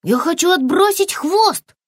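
high, eager small-creature voice; high-pitched childlike insistence; insistent little lizard. Acted. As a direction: declare the wish in a high voice. Lizard's dialogue in story.

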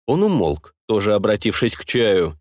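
ordinary delivery, nothing marked

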